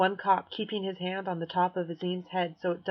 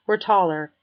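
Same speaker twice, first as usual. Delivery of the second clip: plain reading voice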